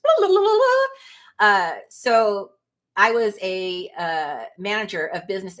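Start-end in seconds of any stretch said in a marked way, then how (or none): 0.0-1.5 mock blah blah. Mocking her own rambling.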